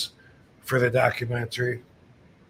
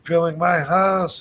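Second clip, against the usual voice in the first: higher-pitched imitation of an excited fan; retold excitement; 5 semitones higher than usual. Homeowner discovering a famous wrestler filming his house, retold excitement. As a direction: imitate a thrilled fan's disbelief. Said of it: "he was all excited".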